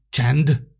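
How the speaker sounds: a sharp, stern "Csend!"; gruff hush. Acted. Old December silences the quarrelling animals, gruffly. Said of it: "mordult rájuk az öreg ember".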